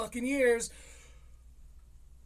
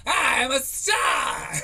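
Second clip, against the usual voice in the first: slow, louder, higher proud shout; kid shouting star; 7 semitones higher, 9 dB louder, slower than usual. Retold or imitated. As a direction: proclaim it like a brat star. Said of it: "talking like Tony Hinchcliffe".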